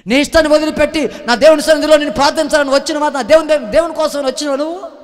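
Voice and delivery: loud, high preaching climax, pressing the question; fiery preaching. Acted. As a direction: hammer each question at the congregation. Preaching at full force. (ఉద్వేగంతో గట్టిగా బోధించడం)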